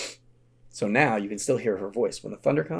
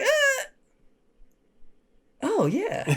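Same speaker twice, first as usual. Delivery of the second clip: put-on little girl realizing; much slower than usual.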